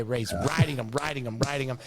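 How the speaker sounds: usual speaking voice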